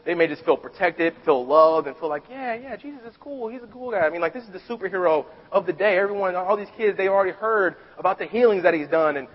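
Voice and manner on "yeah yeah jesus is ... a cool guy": put-on child's voice. Children voiced admiring Jesus.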